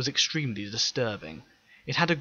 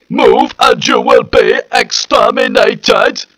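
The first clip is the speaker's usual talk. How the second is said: much higher, louder, slow screeching robot voice; hysterical Dalek; 12 semitones higher, 18 dB louder, slower than usual. Acted. Dalek threatening the fleeing Lutians, hysterical screech. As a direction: shriek the threat at the crowd. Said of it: "the hysterical tone of the first Dalek called out".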